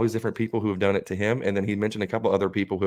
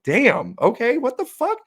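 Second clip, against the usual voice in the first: shocked disbelief; 5 dB louder, slower than usual. Retold or imitated. Shocked reaction to friend's revelations.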